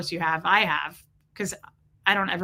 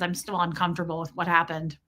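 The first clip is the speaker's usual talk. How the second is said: ordinary talk, nothing marked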